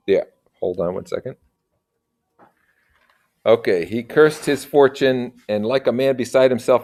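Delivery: plain reading voice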